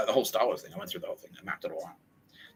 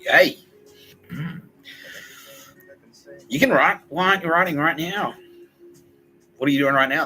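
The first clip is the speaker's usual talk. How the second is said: higher and louder nagging push; pushy coach; 9 semitones higher, 9 dB louder than usual. Retold or imitated. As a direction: lean on him like a demanding coach. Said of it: "Tara Bennett was in there going like".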